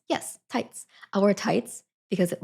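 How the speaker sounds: ordinary talk, nothing marked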